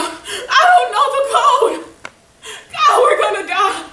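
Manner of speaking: loud, high, slow panicked scream. Retold or imitated. Coworker screaming at the robber she has no code, loud high panic. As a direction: cry out in terror to be spared. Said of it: "And she screamed out".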